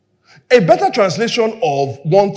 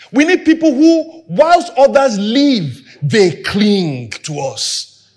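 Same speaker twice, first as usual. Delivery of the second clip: slow emphasis; slower than usual. Real, not acted. Preaching climax, slowed.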